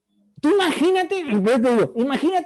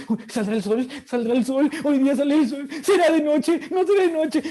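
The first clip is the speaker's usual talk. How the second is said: rising higher with each worried line; mock anxious fretting; 7 semitones higher than usual. Anxious person doubting day and night, climbing higher and higher. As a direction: fret like someone unsure the sun will rise.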